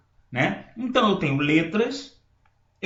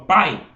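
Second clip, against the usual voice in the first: "pai" with a strong, held "a" and a light glide "i"; stressed a in pai; 6 dB louder than usual. Shown as a demonstration. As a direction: let the "a" of "pai" carry the word. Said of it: "Presta atenção, quando eu falo". ("pai" com o "a" forte e o "i" fraco)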